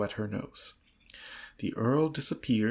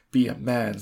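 ordinary delivery, nothing marked

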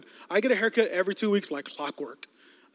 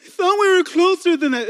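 higher, louder and drawn out, fed-up; mock offended snap; 10 semitones higher, 7 dB louder, slower than usual. Telling off a friend who hurt him, offended. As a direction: slam the door on the friendship.

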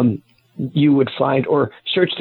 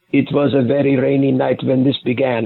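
put-on Russian or Polish accent; pseudo Russian accent. Shown as a demonstration. Author demonstrating how he opens the Rippers' chapters, fake Russian accent. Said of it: "with a pseudo Russian or Polish accent".

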